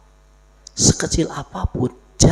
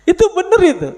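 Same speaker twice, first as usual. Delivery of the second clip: laughing; 6 dB louder, faster than usual. Real, not acted. Laughing remark.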